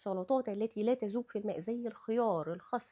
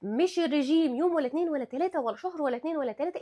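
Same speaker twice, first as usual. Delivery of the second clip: ordinary talk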